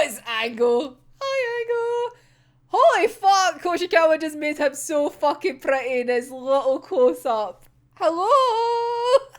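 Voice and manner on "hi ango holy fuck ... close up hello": high gushing hello; excited squeal. Real, not acted. Fan greeting a pretty character, high and gushing. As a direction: coo at him like a crush.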